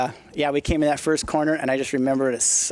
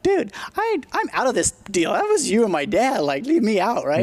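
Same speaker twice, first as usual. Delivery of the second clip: exasperated protest; 8 semitones higher than usual. Retold or imitated. Narrator protesting to Rex after dirt is dumped on him, high mock alarm.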